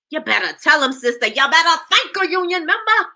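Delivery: high, loud cheering call; excited hype. Real, not acted. Cheering on a commenter in the chat, high and loud.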